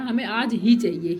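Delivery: ही drawn out and slow, pressed hard; stress on ही. Shown as a demonstration. Teacher showing children how a stubborn kid insists, leaning on ही. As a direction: lean on ही, slowly. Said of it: "इस पे वो जोर दे रहे हैं".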